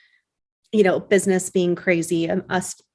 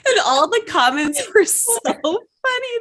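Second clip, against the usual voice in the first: normal talking voice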